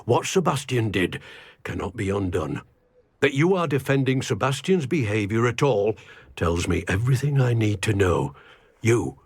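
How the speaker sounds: ordinary talk, nothing marked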